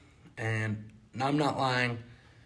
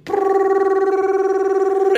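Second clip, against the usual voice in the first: loud, high sustained engine trill; long rotor roar bursting into life; imitated roaring rotor; 11 dB louder than usual. Stalled plane's rotor catches again, imitated loud roaring trill. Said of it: "you hear the rotor go".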